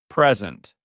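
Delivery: "present" stressed on the first syllable, PREZ-ent; noun stress, first syllable. Shown as a demonstration. Teacher giving a word to say aloud, "present" stressed up front as PREZ-ent. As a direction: punch the "pres" in "present", short e and z sound. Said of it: "you are talking about a noun".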